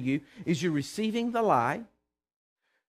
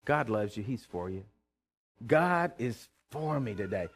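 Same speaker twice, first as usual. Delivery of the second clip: gentle self-talk; 7 semitones lower, 4 dB quieter than usual. Retold or imitated. Preacher recalling comforting himself, softer self-talk. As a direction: talk yourself down kindly. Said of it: "I stopped myself and I said".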